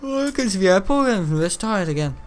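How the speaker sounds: high, silly taunting voice; squeaky mock voice, last word yelled; mocking put-on voice. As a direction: voice a goofy character to mock. Mocking voice for game character.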